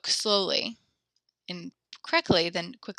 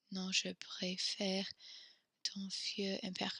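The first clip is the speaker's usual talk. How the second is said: soft unvoiced reading; whisper; 12 dB quieter, slower than usual. Real, not acted. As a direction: whisper the sentence. Reading student answer aloud.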